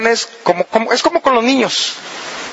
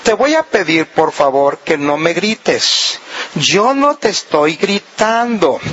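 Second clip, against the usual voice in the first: serious without shouting; 4 semitones lower than usual. Shown as a demonstration. Wife asking husband not to shout, serious and controlled. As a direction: keep it controlled and serious, word by word. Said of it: "la cosa se pone más seria todavía".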